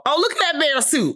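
high, thrilled gasp of a line; jokey high-pitched excitement; delighted surprise. Retold or imitated. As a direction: squeal it like you just spotted treasure. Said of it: "what I did immediately go".

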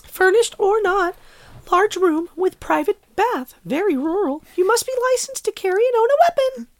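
very high, squeaky, gleeful listing read; way up in pitch, sweetly happy and measured. Acted. Rereads the rental ad happy for co-host, happy. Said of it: "I'll try it happy".

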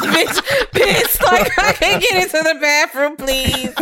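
high, laughing mock desperation; high laughing pretend plea. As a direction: beg while cracking up. Acting a desperate ghost victim.

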